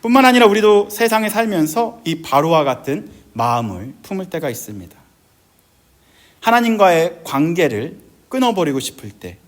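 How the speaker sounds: normal talking voice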